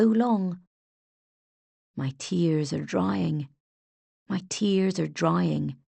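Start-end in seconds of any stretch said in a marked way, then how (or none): 2.0-5.8 slow, hushed lamenting line. A narrator's reading. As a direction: drag each word like a sorrowful song. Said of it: "A soft, lamenting voice started to sing".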